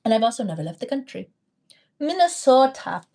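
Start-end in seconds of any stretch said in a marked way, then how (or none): 2.0-3.0 put-on Minnesota accent, higher at first. Vlogger teases Minnesotan viewers about their speech, mock Minnesota accent. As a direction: imitate a Minnesotan saying the state's name. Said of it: "Do y'all actually talk like that?".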